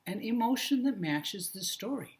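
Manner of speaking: normal talking voice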